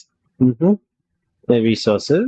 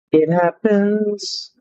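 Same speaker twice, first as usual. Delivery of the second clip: slow, drawn out; 6 semitones higher, much slower than usual. Pretend. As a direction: stretch it slowly, higher. Repeats line drawn out.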